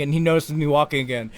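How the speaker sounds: usual speaking voice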